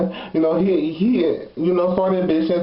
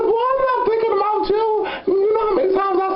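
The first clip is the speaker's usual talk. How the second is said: mocking dismissal; 12 semitones higher, faster than usual. Dismissing the guy's accusation.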